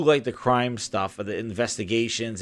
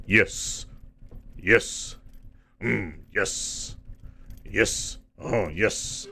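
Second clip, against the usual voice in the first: drawn-out yes; 2 semitones lower, 3 dB quieter, much slower than usual. Retold or imitated. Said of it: "he's like".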